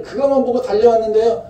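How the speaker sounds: 그것만 high and loud, the whole line cried out; 그것만 보고 달려왔는데요 cried out high; fervent cry. Acted. Voiced confession, repeated line contrast.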